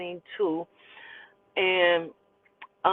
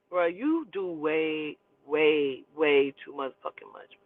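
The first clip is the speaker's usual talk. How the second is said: low, drawn-out fed-up verdict; weary exasperation; 3 semitones lower, 3 dB quieter, slower than usual. Retold or imitated. Daughter fed up with daddy's antics, slow exasperation. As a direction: stretch each "way", dropping lower, exasperated.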